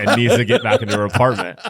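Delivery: laughing through the quote; can't hold back a laugh while telling it; giggling as the story gets funny. Real, not acted. Retelling mom's call, laughing.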